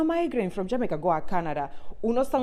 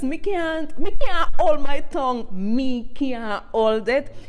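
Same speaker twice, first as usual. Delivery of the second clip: loud, exasperated outburst that peaks on "me can't hold my tongue"; bursting out; 5 semitones higher, 8 dB louder, slower than usual. Real, not acted. Vlogger bursting out about her own accent, loud and exasperated. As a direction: let it burst out of you.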